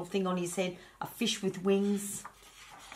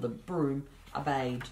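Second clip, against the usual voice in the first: plain reading voice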